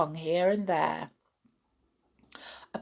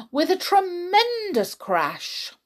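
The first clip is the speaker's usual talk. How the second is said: high, slow and loud; dramatic; 12 semitones higher, 4 dB louder than usual. A narrator's reading. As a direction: build the crash up big. Storyteller dramatizing the crash.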